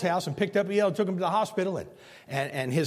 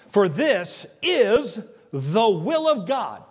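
slow, weighty scripture; 4 semitones higher, 5 dB louder, much slower than usual. Acted. Preacher quotes scripture slowly.

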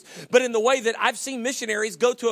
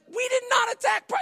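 indignant shout. Retold or imitated. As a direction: shout back in mock outrage. Co-pilot protests in joke.

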